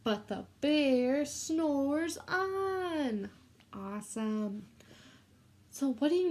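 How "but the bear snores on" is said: very slow, drawn-out chant; slow sing-song refrain for children to repeat. Shown as a demonstration. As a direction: stretch every word so the kids can join in. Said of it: "this is your turn".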